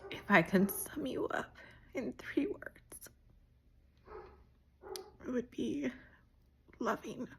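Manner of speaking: high, tearful, half-whispered words; fighting tears while listing her qualities; holding back tears. Real, not acted. Sister in birthday video to her sister, choking up. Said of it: "without crying".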